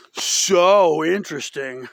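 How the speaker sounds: long, loud SO dripping with sarcasm; sarcastic emphasis. Real, not acted. Vlogger mocking the Kardashians' appeal, sarcastic stress on "so". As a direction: stretch and punch "so" in mockery.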